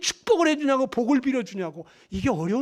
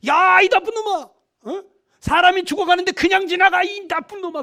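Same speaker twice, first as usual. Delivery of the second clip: loud, high furious scolding; angry yelling; 8 semitones higher, 8 dB louder than usual. Retold or imitated. Bystanders yelling at a man who walked past, furious. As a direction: shout him down as a villain. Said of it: "그러면서 막 소리 지르고".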